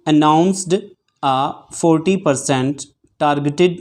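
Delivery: very slow, phrase by phrase with pauses; steady, deliberate dictation read; dictation at eighty words per minute. Shown as a demonstration. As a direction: read it out for shorthand students to take down. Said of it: "मैं exact eighty की speed बोलूँगा".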